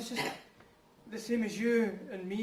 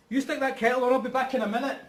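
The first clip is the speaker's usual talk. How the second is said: urgent; 5 semitones higher, 8 dB louder than usual. Acted. Urgent dash to the toilet.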